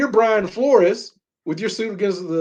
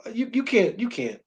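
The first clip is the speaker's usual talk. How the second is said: normal talking voice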